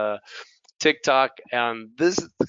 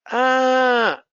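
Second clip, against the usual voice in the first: loud, high, drawn-out "aah"; acted sudden dawning, held high; exaggerated aha moment; long "ah" of realization; 11 semitones higher, 6 dB louder than usual. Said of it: "en ese momento me doy cuenta".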